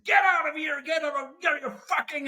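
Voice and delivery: high-pitched shout of an enraged executive; hammed-up furious yell; aggressive yell. Executive throwing the newcomer out of his office, aggressive yell. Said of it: "he become even more, you know, more aggressive".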